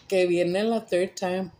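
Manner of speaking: ordinary delivery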